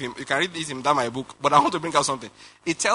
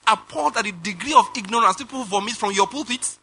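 exasperated plea to God; 6 semitones higher than usual. Retold or imitated. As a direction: appeal to heaven in mock despair, pitched up. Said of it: "sometimes I say".

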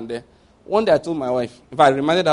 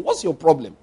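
ordinary delivery

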